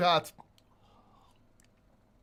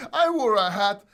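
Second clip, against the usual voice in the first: exasperated; 8 dB louder than usual. Real, not acted. Repeats exasperated.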